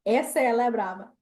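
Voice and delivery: ordinary talk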